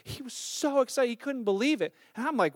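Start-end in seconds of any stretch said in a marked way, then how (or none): none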